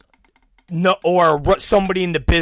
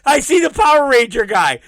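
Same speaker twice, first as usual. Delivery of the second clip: normal talking voice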